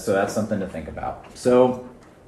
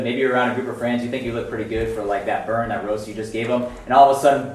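usual speaking voice

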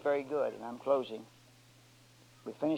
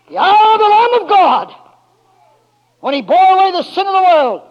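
full-force preaching; 11 semitones higher, 14 dB louder than usual. Acted. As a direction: hold every word high and long.

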